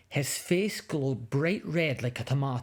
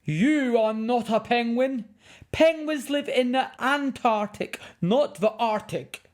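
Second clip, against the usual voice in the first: high, loud, indignant man scolding; furious filmmaker; 9 semitones higher, 5 dB louder than usual. Acted. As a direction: tell off the naughty cub in outrage. Filmmaker corrects cub.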